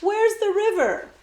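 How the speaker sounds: high, loud call after her; boy shouting after the girl. Acted. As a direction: shout to stop her leaving. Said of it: "Jemmy shouted".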